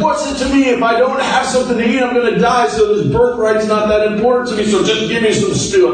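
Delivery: high, dismissive drama-queen complaint; Esau. Acted. Hungry Esau waving off his birthright to his brother, melodramatic. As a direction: rush it out high, with mock exasperation. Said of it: "Esau was kind of a drama queen".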